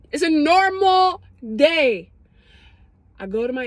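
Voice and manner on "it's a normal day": mock cheerful normal day. Repeats in put-on voice.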